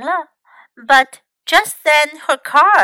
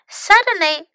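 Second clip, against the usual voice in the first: plain reading voice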